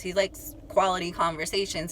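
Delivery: usual speaking voice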